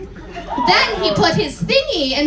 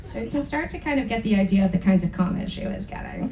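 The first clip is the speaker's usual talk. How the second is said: ordinary delivery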